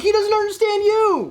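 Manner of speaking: exasperated. Retold or imitated. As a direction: plead with dad in disbelief. Exasperated at dad.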